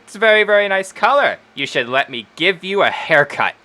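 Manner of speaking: high-pitched, quick gush into a coaxing offer; coaxing halfling Wendy. Acted. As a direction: push a haircut on a customer with showy flattery. Voices Wendy pushing a haircut.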